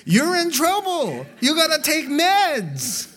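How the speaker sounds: high, loud, comic exclamation; hammed-up loud warning; mock alarmed shout. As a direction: shout it high, stretching the end. Joking outburst at imagined person.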